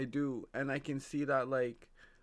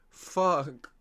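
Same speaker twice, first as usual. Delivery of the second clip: loud exasperated curse; frustrated shout; 4 semitones higher, 12 dB louder than usual. Real, not acted. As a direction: blurt it out in frustration. Frustrated outburst at own hairline.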